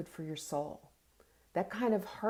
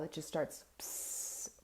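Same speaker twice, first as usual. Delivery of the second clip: normal talking voice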